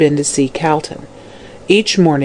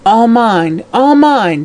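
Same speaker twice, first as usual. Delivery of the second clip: high possessive cry; old hag's acted shriek; loud shriek; 5 semitones higher, slower than usual. A narrator's reading. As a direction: climb higher on the repeat, clutching at every word. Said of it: "she shrieked loudly".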